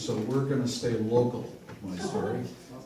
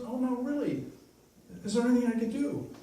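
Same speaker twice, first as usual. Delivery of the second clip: lightly voiced concerned woman; 12 semitones higher, faster than usual. Woman presses old man.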